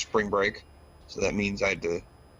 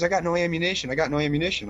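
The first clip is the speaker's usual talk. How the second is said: high-pitched imitation of a kid; whining game kid; 8 semitones higher, faster than usual. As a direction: plead like a kid gamer out of supplies. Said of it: "this kid's like".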